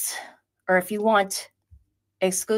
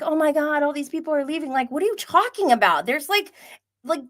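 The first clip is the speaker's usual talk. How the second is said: high, louder exasperated disbelief; mocking the viewers' panic, higher; incredulous mock rant; 10 semitones higher, 4 dB louder than usual. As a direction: scoff at the fuss.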